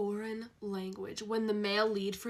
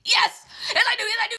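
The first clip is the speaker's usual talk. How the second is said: high loud laughing yes; giddy yes; 13 semitones higher, 11 dB louder, faster than usual. Pretend. Fan answering hero, giddy squeal. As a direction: shout it giddily.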